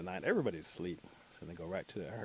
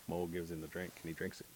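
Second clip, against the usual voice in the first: usual speaking voice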